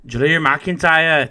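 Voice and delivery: slow, drawn-out Scottish accent on the name; rolled Scottish wrestler-announcer accent. Shown as a demonstration. Podcaster doing a Scottish accent while reading a list. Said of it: "that was my Scottish accent".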